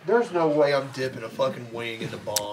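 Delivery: speaking with numbed burning throat; strained through a numb throat. Real, not acted. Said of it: "I can't feel my throat".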